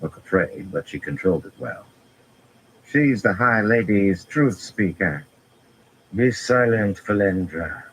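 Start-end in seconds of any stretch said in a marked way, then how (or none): 2.9-5.2 slow, slightly higher twang; a light drawling Seanchan accent; loud twangy drawl. A narrator's reading. The eldest Suldam correcting Rand, loud and drawling. 6.1-7.8 cold. A narrator's reading. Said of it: "Semirhage said coldly".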